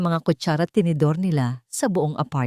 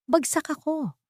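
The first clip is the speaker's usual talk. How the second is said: high, understated tearful voice; between sobs; 11 semitones higher than usual. A narrator's reading. Ara confesses failure to her friend, mildly sobbing. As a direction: lightly act a tearful confession, pitched up. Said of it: "sa pagitan ng mga hikbi".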